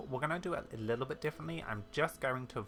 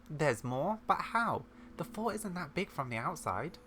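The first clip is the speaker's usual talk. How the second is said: higher-pitched, puzzled amazement; astonished; 7 semitones higher than usual. Acted. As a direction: ask in amazed disbelief. Visitor amazed at hidden levels.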